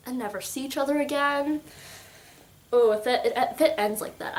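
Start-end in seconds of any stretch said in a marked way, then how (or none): none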